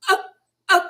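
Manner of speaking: short choking gasps, acted out; imitated gasping for air. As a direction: mimic being unable to get a breath. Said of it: "I'm gasping for air".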